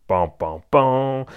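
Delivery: ordinary delivery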